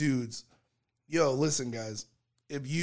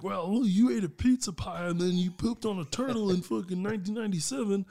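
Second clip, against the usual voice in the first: high, mocking accuser voice; mock hater; 4 semitones higher than usual. Mimicking a hater who digs up a silly accusation. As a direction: taunt like a petty online hater. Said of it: "you're like".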